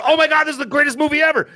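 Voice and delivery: high, gushing fake amazement; mock-thrilled moviegoer. Acting out a friend leaving a blockbuster, mock-thrilled.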